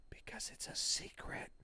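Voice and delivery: breathy secretive whisper, no voice; playful whisper. Pretend. Teasing viewers with a secret, whispered. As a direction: hush it like sharing a secret. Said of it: "Why are you talking like that?".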